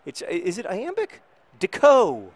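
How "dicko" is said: louder, lingering on the name; fond, drawn-out naming; savouring the word. Real, not acted. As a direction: say it like a line of poetry. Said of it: "It's just a beautiful word".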